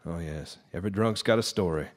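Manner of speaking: normal talking voice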